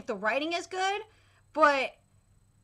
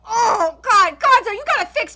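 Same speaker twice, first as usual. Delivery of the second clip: exasperated outburst; 3 semitones higher, 7 dB louder than usual. Real, not acted. Upset at how Mizuki looks.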